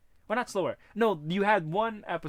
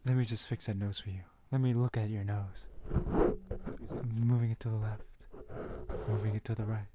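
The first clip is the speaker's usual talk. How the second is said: hushed ASMR doctor voice, lowered; whispered asmr role play; 4 semitones lower than usual. Pretend. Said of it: "A S M R roleplay".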